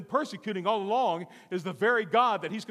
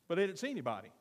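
ordinary delivery

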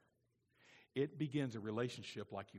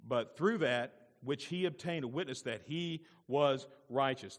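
normal talking voice

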